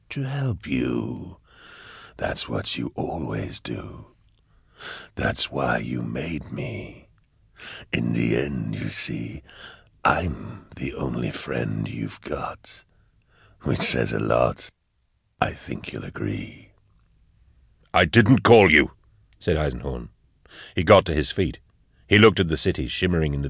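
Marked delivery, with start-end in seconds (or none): 0.1-16.7 low, slow, hushed demon; Cherubael. A narrator's reading. Cherubael claims to be Eisenhorn's only friend, slow and eerie. 17.9-18.9 higher, louder protest; heated denial. A narrator's reading. Eisenhorn denies calling Cherubael, heated and loud.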